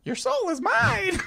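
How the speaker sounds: very high, slow, echoing villain voice; echoing voice inside helmet; Shang Tsung voice. Shown as a demonstration. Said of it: "Just however feels right".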